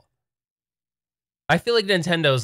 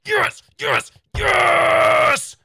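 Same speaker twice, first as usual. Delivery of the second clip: high-pitched hyped-up rallying; pumped; 14 semitones higher, 4 dB louder than usual. Real, not acted. Streamer on caffeine begging for topics, wildly pumped. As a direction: whip the chat up, climbing to a screech. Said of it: "I am feeling pumped".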